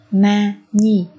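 "ma nhi" slowly, the n carried into the second syllable; two slow, separate syllables, "ma" then "nhi"; linked sounds. Shown as a demonstration. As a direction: demonstrate linking as "ma nhi". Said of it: "Ở đây chúng ta nối âm man và y".

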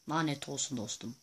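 ordinary delivery

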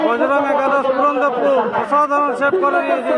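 high, loud thrilled shout; excited save call. Real, not acted. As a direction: cheer the save. Keeper saves the shot. (উত্তেজিত উঁচু গলায় সেভের ঘোষণা)

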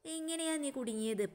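high little parrot asking. Acted. Parrot asks the stuck kite, in a high bird voice. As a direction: pitch up into a small bird's voice. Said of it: "തത്തമ്മ".

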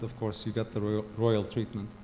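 ordinary talk, nothing marked

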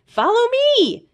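very high, eager call; high eager creature beckoning; Zeke calling out. A narrator's reading. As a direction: squeak it like an excited little guide. Zeke's voice in the story.

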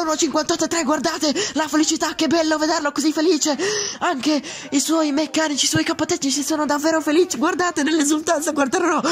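excited rapid play-by-play; breathless italian goal commentary. Acted. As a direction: call the lap live, breathless. Excited live pole call.

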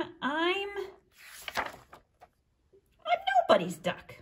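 lively read-aloud with high character voices; storytime reading. A narrator's reading. Librarian reading to children, animated character voices. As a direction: read the duck story to the kids, bouncing between voices. Reads picture book with voices.

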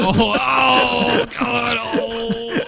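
hammed-up pained shouting; mock pain cry. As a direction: cry out as if stabbed. Knife pulled from back.

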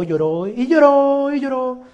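alargado, agudo y cantadito; exagerado. Real, not acted. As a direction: estira cada palabra subiendo el tono, con burla. Exaggerating how much she cried. (exagerando, agudo y arrastrado)

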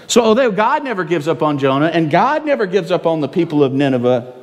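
usual speaking voice

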